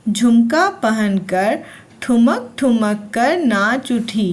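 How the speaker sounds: ordinary talk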